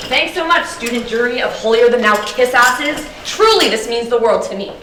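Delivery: loud, exaggerated mock gratitude; sarcastic thanks. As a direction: gush it with biting sarcasm.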